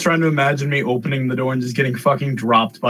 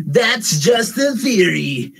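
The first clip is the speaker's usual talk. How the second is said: slow mock shout of the catchphrase; hammed-up slow yell; much slower than usual. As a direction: belt it like a hyped YouTuber. Mocking YouTube catchphrase.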